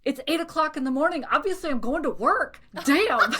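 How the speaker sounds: high, fast mock-indignant rant; exasperated retort. Acted exasperated reply to chatty barista.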